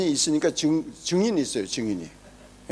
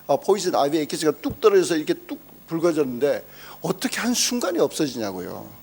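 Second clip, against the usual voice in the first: ordinary talk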